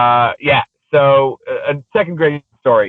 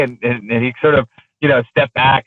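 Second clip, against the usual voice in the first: ordinary talk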